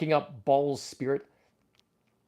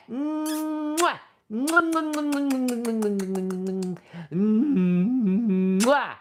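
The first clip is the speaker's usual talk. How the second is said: smooching kiss noises; 6 semitones higher, much slower than usual. Pretend. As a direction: blow kisses for the toys. Kiss sounds for toys.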